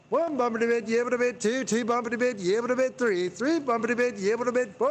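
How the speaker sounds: fast high auctioneer chant, hammed up; mock auctioneer. Streamer auctions off hot chocolate to chat, mock auctioneer chant. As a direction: rattle off bids like an auctioneer. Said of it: "hot chocolate to the highest bidder".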